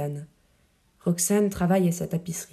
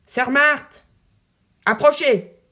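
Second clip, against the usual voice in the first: much higher, louder comic voice, a mock-booming call to the nun; grosse voix comique; 6 semitones higher, 7 dB louder, slower than usual. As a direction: hail the nun like a pompous buffoon, loud and high. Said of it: "Avec une grosse voix comique". (grosse voix comique, plus aiguë et plus forte, pour taquiner sœur Marthe)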